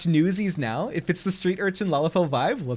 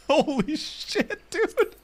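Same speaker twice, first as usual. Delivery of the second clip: high, slow holy shit; stunned; 13 semitones higher, much slower than usual. Real, not acted. Streamer reacting to dead cat lore, stunned. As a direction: gasp it out in amazement.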